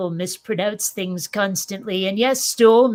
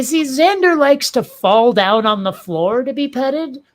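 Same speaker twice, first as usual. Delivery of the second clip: ordinary talk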